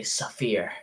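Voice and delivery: "Saphir" with an extra uh between s and f; kid's version of sphere, "Saphir"; childhood mispronunciation. Shown as a demonstration. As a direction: say "Saphir" in two syllables, suh-FEER. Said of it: "when I was a kid, I would say".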